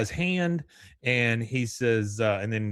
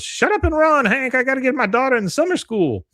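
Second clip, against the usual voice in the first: much higher and louder, fast; mock panicked yell; 14 semitones higher, 7 dB louder than usual. [name] yells at Hank as the crowd chases them, loud and high. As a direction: shout it while running away.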